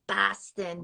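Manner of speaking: "Boston" with a broad flat A, like "Bastin"; Boston accent. Shown as a demonstration. Friend demonstrating Boston talk, "Boston" said as "Bastin". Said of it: "it's a lot of ah".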